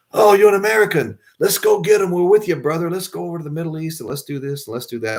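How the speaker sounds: excited Aussie bloke. Retold or imitated. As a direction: play a pumped-up local slapping your back. Australian guys cheering him on.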